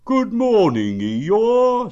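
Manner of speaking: loud, high hello; cheery greeting. Acted. Pooh greeting the old grey donkey, hearty. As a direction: call it out high and loud to a friend.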